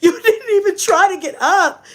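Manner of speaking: high, tearful exasperated complaint; mock near-crying outrage; mad. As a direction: whine it out in disbelief. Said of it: "I was so fucking mad".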